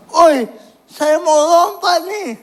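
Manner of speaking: high, loud call across the school; shouting announcement. Retold or imitated. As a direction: yell it out to grab everyone's attention. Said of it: "cuma teriak". (teriakan mengumumkan mau lompat)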